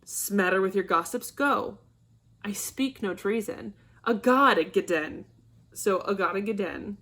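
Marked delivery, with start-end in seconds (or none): 4.0-5.2 annoyed exclamation. Shown as a demonstration. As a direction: spit out "A God, a Gaden" as an irritated oath. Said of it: "is an exclamation of annoyance".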